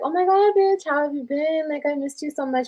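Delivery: high, squealing excited hello; imitating her own overjoyed greeting; gushing greeting. Retelling a phone call from an old friend, squealing excitedly. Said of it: "And I was like".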